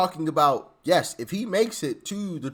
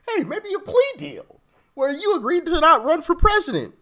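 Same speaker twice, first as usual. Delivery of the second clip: mock high sing-song offer, louder; 11 semitones higher, 5 dB louder than usual. Host mocking prosecutors' plea deal offer, high and sneering. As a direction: sneer it like a fake generous offer.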